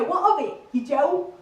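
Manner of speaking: loud, confrontational Essex lad; Essex accent. Acted. Essex lad squaring up to mate, in a loud Essex accent. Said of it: "average conversation goes a little bit like this".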